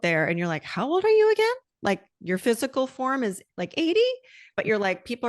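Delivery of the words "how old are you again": high, loud incredulous question; mock amazement. Podcast host mimics amazed listener, high and loud. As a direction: gasp it out in disbelief at her age. Mock surprised quote of bright elder.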